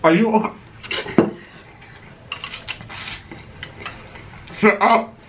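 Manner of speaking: high scolding pet voice; talking to the dog. Real, not acted.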